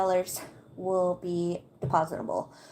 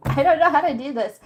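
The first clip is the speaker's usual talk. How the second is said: laughing through words; 5 semitones higher, 5 dB louder, faster than usual. Real, not acted. Laughing at own confusion.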